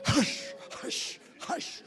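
breathless whispered hush; preacher mocking a man with hot flashes, whispered. Mock hormonal woman.

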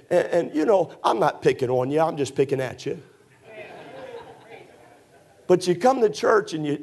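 ordinary talk

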